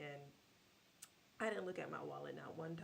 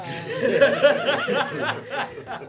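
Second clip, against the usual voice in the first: loud laugh; 10 semitones higher, 23 dB louder than usual. Real, not acted. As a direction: burst of laughter. Laughing at dad's accent.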